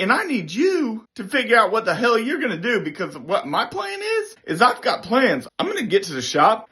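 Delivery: high-pitched, worked-up retold rant; angry demand. Airman warning shop supervisor of revenge plans, angry. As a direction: lay down a threat, pitched high.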